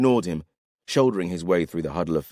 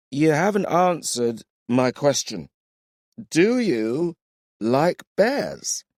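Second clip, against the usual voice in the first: put-on drunk; 12 semitones higher, 4 dB louder, much slower than usual. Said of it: "You're drunk".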